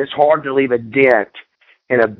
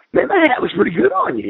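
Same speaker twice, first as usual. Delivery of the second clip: imitating a friend; 11 semitones higher, faster than usual. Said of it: "whoever was with me goes".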